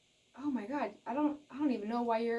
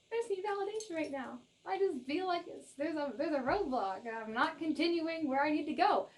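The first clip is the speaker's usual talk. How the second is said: higher, lightly whiny voice; mock unsure viewer; 5 semitones higher than usual. Reader imitating an insecure viewer, lightly whiny. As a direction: play the doubting viewer lightly. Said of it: "you're just a little bit like".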